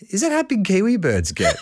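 a giggly naive question; laughing question. Retold or imitated. Traveller asks the counter lady about kiwi size, laughing.

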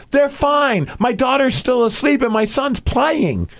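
high, slow, suddenly calm mock voice; breezy high voice, as if nothing happened; over-dramatic woman. Mimicking the woman once calm, high and slow. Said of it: "looks at me and goes".

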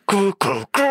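high sung "gu, gu, gu" sting; imiteret quiz-jingle. Retold or imitated. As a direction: voice the quiz show's suspense sound. Efterligner quizzens lydkulisse. (efterlignet quizjingle, højt i tonelejet)

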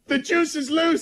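high, slow mock bird cry; birds' alarm call. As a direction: squawk it like the birds warning each other. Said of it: "what all the birds are saying".

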